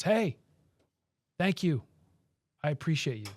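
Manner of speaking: mock call across the street, high at first, easing down; retold yell. Local guy calls to cameraman across the street, retold yell. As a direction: imitate someone calling over from a distance, not at full volume. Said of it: "yells over to me".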